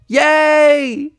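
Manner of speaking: loud, high filly cheer. Acted. Three fillies celebrating the job, loud cheer. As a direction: shout with joy.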